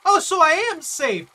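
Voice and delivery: loud, high, relieved cry; excited relief. Real, not acted. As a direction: burst out in glad surprise. Relief at discovering safety.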